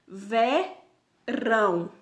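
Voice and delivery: "verão" very slow and loud, split as ve - rão; syllable-by-syllable stress demo. Shown as a demonstration. Teacher showing pupils the stressed syllable, "verão" split with weight on rão. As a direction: break "verão" in two, leaning on the final rão. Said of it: "ele é mais acentuado que vé".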